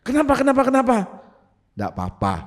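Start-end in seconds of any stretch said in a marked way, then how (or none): 0.0-1.0 alarmed questioning. Acted. Alarmed onlookers react to the scream.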